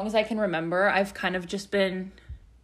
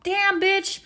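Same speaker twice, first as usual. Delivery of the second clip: mock excited; 11 semitones higher than usual. Mock reaction to haul.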